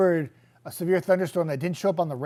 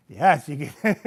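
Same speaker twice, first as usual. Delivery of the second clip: laughing; 5 semitones higher, 8 dB louder than usual. Real, not acted. Laughing at viewer's voice joke.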